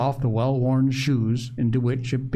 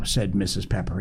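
ordinary delivery

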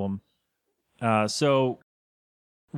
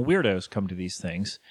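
ordinary talk, nothing marked

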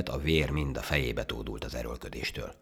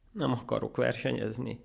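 high, unraised whine; complain, close to tears; tearful; 10 semitones higher than usual. Acted. Ant refusing to race the monkey, tearful. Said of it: "mondta ekkor sírósan a hangya".

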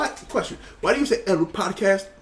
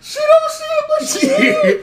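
much higher, laughing through the words; laughing repeat. Shown as a demonstration. Friend repeats the joke, cracking up laughing. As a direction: giggle it out, squeaky and amused.

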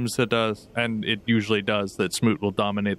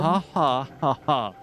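fake robot laugh. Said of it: "an ungenuine laugh".

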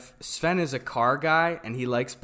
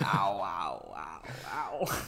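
low groaning ow; stomach groaning ow; 7 semitones lower, 8 dB quieter, slower than usual. Pretend. Stomach gurgling impression.

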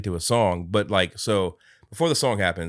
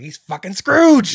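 excited realization; 12 semitones higher, 11 dB louder, slower than usual. Retold or imitated.

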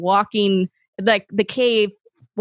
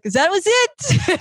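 high-pitched mock French guide; bad French accent; 10 semitones higher than usual. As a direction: chirp it in a put-on French accent. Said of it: "this is a horrible French accent".

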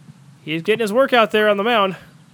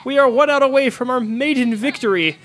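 rising thrill near victory; eager, loud, building hope; excited; 6 semitones higher, 5 dB louder than usual. Real, not acted. One out from first win.

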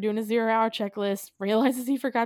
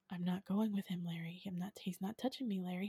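imitated near-whisper, very soft; hushed refusal; 3 semitones lower, 14 dB quieter than usual. Ruth backstage objecting to Larry, hushed protest. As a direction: refuse under the breath, barely voiced. Retold nurse refuses under her breath.

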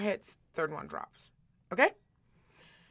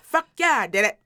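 [name] yelling; 6 dB louder than usual. Retold or imitated. Retelling the sketch, loud [name] impression. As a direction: belt it out like Chappelle's [name]. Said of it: "And he goes".

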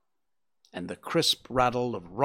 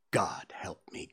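despairing whisper; 6 semitones lower, 8 dB quieter than usual. Acted. Thief breaks down confessing.

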